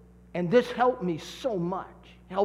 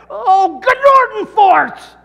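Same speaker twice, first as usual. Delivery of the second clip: hammed-up angry yell, much higher and louder, drawn slow; mock frustrated outburst; 14 semitones higher, 16 dB louder, much slower than usual. Showing the church how to vent when upset, mock yell. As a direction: explode with fake frustration. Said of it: "great word to say if you get upset".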